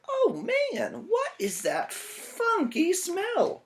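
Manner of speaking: very high, slow cry of disgust; disgusted fox. Acted. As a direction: gag at the stink as the fox. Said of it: "the fox ... said".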